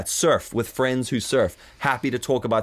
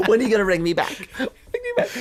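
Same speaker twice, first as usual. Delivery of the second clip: high, laughing sing-out; hammed-up needy goodbye; mock clingy caller; 11 semitones higher than usual. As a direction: plead in a silly high voice through laughter.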